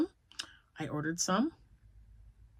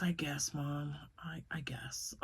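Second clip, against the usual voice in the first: whispered, grudging give-in; imitating a reluctant whisper; 4 semitones lower, 11 dB quieter, slower than usual. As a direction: mimic the daughter caving in a whisper. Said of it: "She goes".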